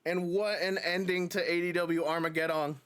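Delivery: wrestling commentator. Acted. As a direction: let the result sink in. Wrapping up the match.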